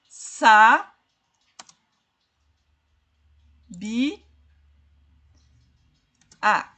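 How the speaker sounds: isolated syllables with long gaps; separando sílabas. Shown as a demonstration. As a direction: spell out the word syllable by syllable. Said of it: "Vamos separar". (sílaba por sílaba, pausadamente)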